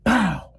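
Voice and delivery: short, sudden vocal bang; imitated gunshot. Storyteller mimicking the gunshot he heard, sudden bang. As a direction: mimic a gun going off. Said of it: "That motherfucker said".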